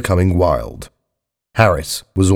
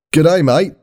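high, cheery Australian greeting; Australian caller, pitched high; Australian accent; 8 semitones higher than usual. A narrator's reading. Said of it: "a voice with an Australian accent".

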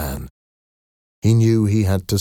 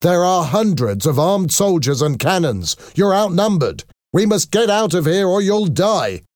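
high-pitched, urgent warning; urgent shout; 11 semitones higher than usual. A narrator's reading. Valjean urges Marius to flee the cannons, shouting. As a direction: warn him urgently, climbing higher as it goes. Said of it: "ran to Marius and shouted".

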